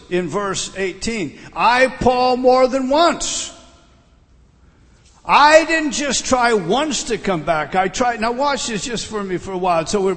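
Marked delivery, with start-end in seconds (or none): 1.5-8.2 insistent. Acted. Preacher voicing Paul's repeated attempts.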